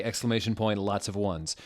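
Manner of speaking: ordinary talk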